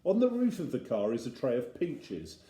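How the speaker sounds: ordinary delivery, nothing marked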